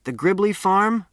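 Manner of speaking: a lightly voiced man calling back as he leaves. A narrator's reading. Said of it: "he called back".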